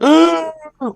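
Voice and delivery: sharp high gasp of alarm. Retold or imitated. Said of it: "They're like".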